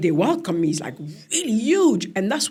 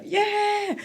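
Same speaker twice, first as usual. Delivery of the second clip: high crowd cheer; 13 semitones higher than usual. Retold or imitated. Villagers cheering the crowning, a high whoop. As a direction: whoop it out for the new queen.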